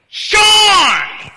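long, high, blasting holler; all-out wake-up yell. Acted. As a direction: lean in and bellow the name. Leans forward and yells.

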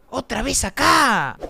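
loud, high, drawn-out cry; panicked yell. Real, not acted. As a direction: wail it out in alarm. Scared by recurring game scare. (grito alargado de susto)

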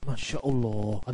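low, very soft exclamation; hushed awe. Retold or imitated. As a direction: imitate a cleric murmuring in amazement. Retold kiai's awed reaction.

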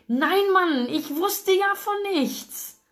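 high, slow indignant whine; exasperated protest. A narrator's reading. Frustrated man defends himself to friend, high whine. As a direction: plead innocence, pitch up.

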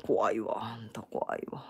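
slow, hushed, mock-scared whisper. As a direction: pretend to be creeped out, under your breath. Mock fear at his line.

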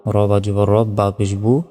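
usual speaking voice

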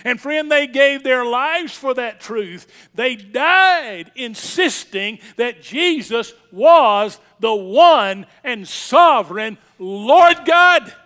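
slow, forceful pulpit proclamation; emphatic preaching. Real, not acted. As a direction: drive each phrase home to the congregation.

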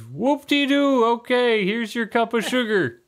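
high, slow mock-unimpressed; sarcastic old man. Old neighbor hands over sugar, sarcastic high voice. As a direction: roll it out sarcastically. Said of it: "He says".

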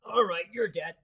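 loud threatening line; menacing. Retold or imitated. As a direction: bark out the threat. Voicing Doc coming after enemy.

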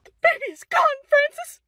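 high, loud frantic wail; panicking over the missing baby; panicked cry. Acted. Mother cries out to Francis that baby's gone, frantic wail.

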